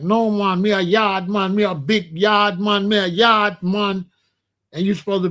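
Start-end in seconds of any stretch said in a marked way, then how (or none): 0.0-4.1 mock Jamaican yard man boast, Jamaican accent. Mocking Rastas who call themselves yard men, Jamaican accent. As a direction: imitate a proud Jamaican insisting, in Jamaican patois accent. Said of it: "The other one's like".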